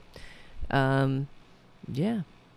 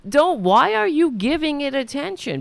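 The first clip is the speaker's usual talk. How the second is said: high, loud, indignant outburst; exasperated challenge; 11 semitones higher, 7 dB louder than usual. Pretend. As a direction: burst out loudly, voice pitched way up. Repeats exasperated challenge to couple.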